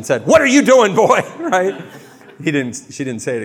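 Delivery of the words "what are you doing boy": higher, louder scolding mother. Retold or imitated. Mary scolding lost boy Jesus, loud higher scolding mom.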